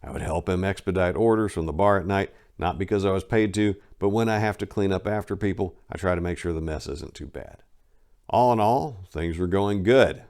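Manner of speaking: ordinary delivery, nothing marked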